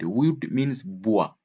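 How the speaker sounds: ordinary talk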